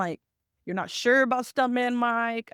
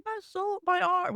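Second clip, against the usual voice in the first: high, whiny mock whimper; hammed-up wail of a beaten villain; mock crying; 9 semitones higher, slower than usual. Host imitating the crying villain after the women beat him, mock whimper. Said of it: "the way he's crying".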